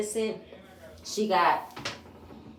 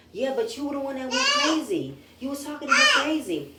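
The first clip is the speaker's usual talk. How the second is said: higher, sassy retort voice; a put-on Stormy voice, indignant and pitched up; heated comeback; 7 semitones higher than usual. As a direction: throw the accusation back at her.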